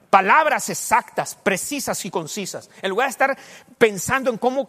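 loud, emphatic sermon delivery; full-force preaching; fervent preaching. Real, not acted. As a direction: drive the point home to the congregation.

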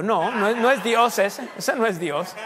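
loud and high, laughing it off; no, that's not God, call it out; emphatic denial. Real, not acted. Pastor laughingly rebukes the idea that God said rob a bank. Rejecting false guidance, laughing.

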